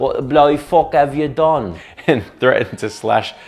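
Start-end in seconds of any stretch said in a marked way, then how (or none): 0.0-1.7 higher, louder mock outrage; hammed-up British indignation; outraged football official. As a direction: scold them as a scandalised English official. Voicing the outraged English F A.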